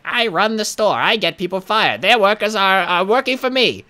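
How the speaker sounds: mock her self-importance in a put-on high voice; imagined Betty gloating. Customer boasting she got a bagger fired, high mocking caricature. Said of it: "I'm sure she's like".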